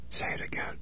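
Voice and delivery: terrified whisper; almost no voice at all; faint frightened whisper. A narrator's reading. Lydia barely voiced, terrified.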